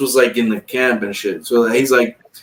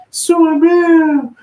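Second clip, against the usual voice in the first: mocking tattletale voice; 4 dB louder than usual. Cellmate snitching his name.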